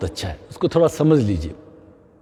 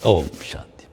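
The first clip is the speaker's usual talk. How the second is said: calm blessing; 12 semitones lower, 6 dB quieter than usual. Shown as a demonstration. Closing yoga chant.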